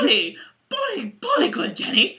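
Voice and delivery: high, jolly British cheer; Chummy. Acted. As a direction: gush in a plummy British accent. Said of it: "might be my best accent show".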